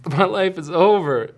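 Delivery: high, loud, melodramatic wail; retold freak-out. Dad-to-be retelling his worst reaction, mock freaking out. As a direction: overplay the despair for comic effect. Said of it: "freaking out".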